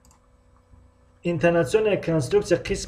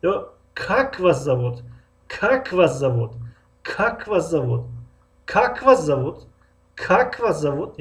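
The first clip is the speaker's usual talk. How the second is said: Как вас зовут said slowly, the first word как drawn out; slow stretched question; slower than usual. Shown as a demonstration. Teacher drilling the question for students, slow stretched start. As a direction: linger on как, rest short. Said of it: "аввалро мекашем, боқимондаро намекашем".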